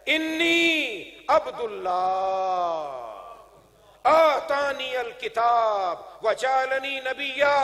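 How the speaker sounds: put-on preacher declaiming the miraculous child's words; infant Jesus proclaiming. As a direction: stretch each Arabic word out ringingly. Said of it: "اور کیا کہتا ہے؟".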